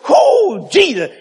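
high, loud exclamation; acted awed cry. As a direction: cry out in overwhelmed wonder. Acts out Moses seeing God's goodness.

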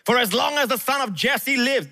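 high-pitched fury at a son; Saul's rage. A narrator's reading. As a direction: scold your son like an enraged king. Saul raging at Jonathan.

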